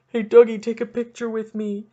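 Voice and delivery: high, loud, pleading fan; hammed-up overeager hockey fans; pushy fans. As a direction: beg the star like a gushing parent. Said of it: "fifty more people after that".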